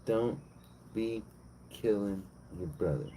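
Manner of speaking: normal talking voice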